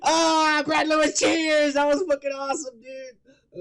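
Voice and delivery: loud, high laughing toast; excited cheer. Real, not acted. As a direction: raise a glass, thrilled.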